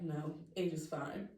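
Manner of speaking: low, soft, slow reassurance; calm answer. Retold or imitated. Family answers the worried sister, calm and low. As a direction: play it down quietly, slowly.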